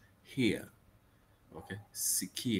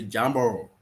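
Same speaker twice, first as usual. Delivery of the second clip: loud tourist-accented Swahili greeting; 2 semitones higher, 7 dB louder than usual. Shown as a demonstration. Newcomer greeting a local, tourist accent. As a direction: greet loudly like a foreign tourist. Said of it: "with your tourist accent".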